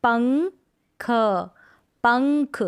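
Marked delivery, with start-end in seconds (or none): 0.0-1.1 पंख stretched very slow, syllable by syllable; slow syllable split. Shown as a demonstration. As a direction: draw out पंख slowly for the class. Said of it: "पढ़ने का अभ्यास करेंगे". (पंख को बहुत धीरे तोड़कर)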